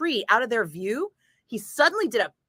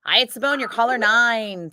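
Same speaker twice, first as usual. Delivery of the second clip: brisk, upbeat station greeting, louder; radio phone greeting; 3 semitones higher, 3 dB louder than usual. Acted. DJ answering contest callers with upbeat greeting. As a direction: chirp the station name to each caller.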